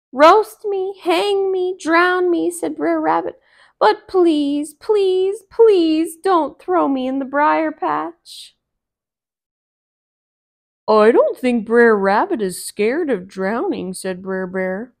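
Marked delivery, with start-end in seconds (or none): 0.1-8.5 high loud mock pleading; beg, please please please; begging rabbit. Rabbit pretends to beg the fox, loud high. 10.9-13.9 bear voice. Acted. Bear doubts the rabbit's fear, different voice.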